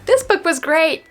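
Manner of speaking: ordinary talk, nothing marked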